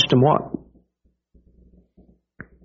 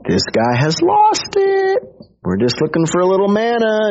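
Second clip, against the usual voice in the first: incredulous; 9 semitones higher than usual. Retold or imitated. Pharisees complaining about Jesus's strange talk, high incredulous whine. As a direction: stretch it out like he's gone mad. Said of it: "They're like".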